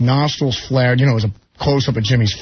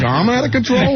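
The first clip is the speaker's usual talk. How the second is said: much higher, mimicking [name]'s wail; high imitation of [name]; 13 semitones higher than usual. Guitarist mimics [name] for the host, much higher. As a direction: pitch it way up, a mock [name] cry. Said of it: "It's just".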